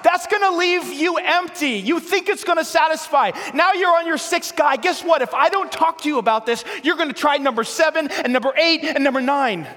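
high, loud, driving preacher's cry; fiery sermon climax; preaching at full force. Acted. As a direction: hammer it home to the crowd. Preacher building to a shout.

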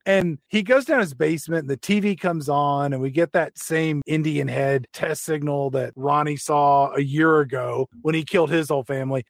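usual speaking voice

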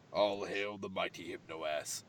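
low, hushed mock proclamation; mock mystical. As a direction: intone it like a cult chant. Mock worship of dancer.

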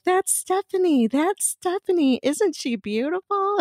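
high imitation of excited girls; gushing. Counter girls telling the owner about a customer, imitated high gushing. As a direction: show someone off in a high, drawn-out gush.

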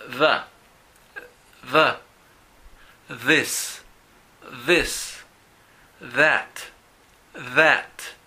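"the" and "this" with a buzzing th, voice on, tongue between the teeth; voiced th drill. Shown as a demonstration. Teacher models the voiced th for Spanish speakers to repeat, each word twice. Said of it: "repitan después de mí".

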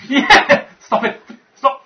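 laughing stop it; loud laughing protest. Real, not acted. Laughing at a sniffing dwarf.

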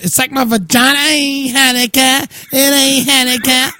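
high-pitched goofy mock wail; silly high voice. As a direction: clown it up, high and drawn.

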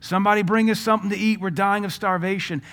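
high, quick pleading cries; imitated screams. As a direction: plead from afar in a half-screamed retelling. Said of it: "I can hear these screams".

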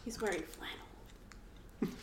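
breathy hushed aside, slow; whispering. Real, not acted. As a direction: whisper it slowly to a friend. Hushed remark while watching.

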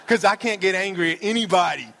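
high, loud comic complaint; mock exasperation. Pastor griping to his congregation, mock exasperation. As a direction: burst out in playful protest.

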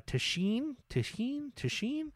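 "Tishin" tried as a question, pitch rising high; unsure pronunciation. Real, not acted. Host unsure of a director's surname, questioning. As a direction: test out "Tishin" softly, lifting the end each time.

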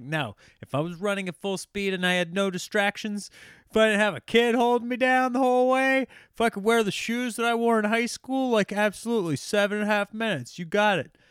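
worked-up mock boasting, high and loud; cocky boast. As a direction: argue them down with total confidence. Acted cocky boast to doubters.

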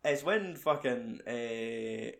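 ordinary delivery

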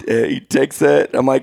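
normal talking voice

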